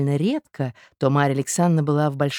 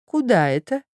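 mildly sympathetic, concerned question, a bit higher; extraordinary sympathy; 6 semitones higher than usual. A narrator's reading. Hostess asks departing guest with mild sympathy. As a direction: ask it with exaggerated concern. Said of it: "спросила с необыкновенным соболезнованием".

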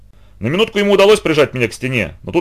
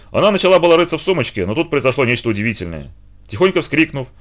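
ordinary delivery, nothing marked

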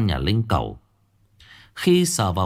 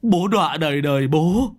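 acted lament, pitched way up; wailing sobs; 10 semitones higher than usual. A narrator's reading. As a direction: cry out to the child in despair. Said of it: "ôm mặt khóc tu tu".

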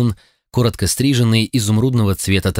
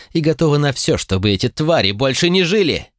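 furious shout; 8 semitones higher than usual. A narrator's reading. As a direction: shout it with raw hatred. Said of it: "выкрикнула с такой яростью". (яростный выкрик)

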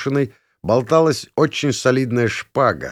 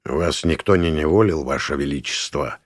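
low voice with a faint tiger growl; a barely there tigerish rumble underneath; 7 semitones lower than usual. A narrator's reading. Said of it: "в голосе Беловолосого слышится едва заметный рокот, напоминающий тигриное рычание".

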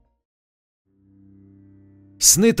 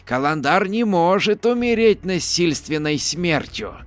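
creaky voice; 5 semitones higher than usual. Acted. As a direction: creak it out like an ancient crone. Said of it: "довольно поддержала".

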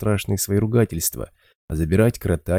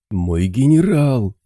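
loud slow desperate yell; shouting; 6 semitones higher, 5 dB louder, slower than usual. A narrator's reading. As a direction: yell for your general. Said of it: "орал Буль".

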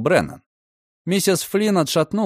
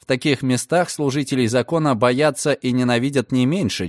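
plain reading voice

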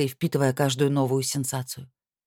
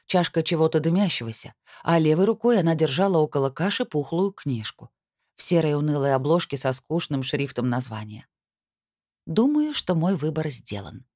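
usual speaking voice

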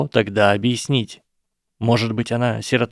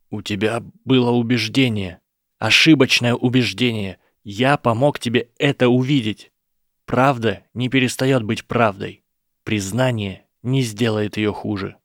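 usual speaking voice

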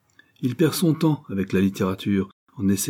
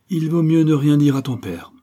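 raised, louder, firm mother; 7 semitones higher, 6 dB louder than usual. A narrator's reading. Mother settles matters for her daughter, firm and raised. Mother firmly takes charge.

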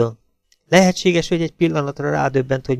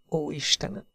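"Ó, Istenem!" whispered, much softer, rising at the end; whispered prayer; 4 semitones higher, 11 dB quieter than usual. A narrator's reading. Hazel repeating it in shock behind the tree, whisper. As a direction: breathe "Ó, Istenem!" again, almost voiceless. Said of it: "suttogta újra meg újra".

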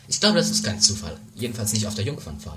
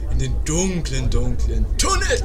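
spooky drawn-out words; 5 dB louder, slower than usual. Acted. Spooky repetition of the tunnel.

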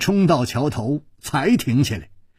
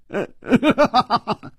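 high falling laugh; falling chuckle; hearty laugh; 10 semitones higher than usual. A narrator's reading. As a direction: laugh it all away. Hero laughs off the question.

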